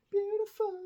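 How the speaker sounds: beautiful squeaked very high; high squeaky beautiful. Shown as a demonstration. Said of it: "teacher repeating word".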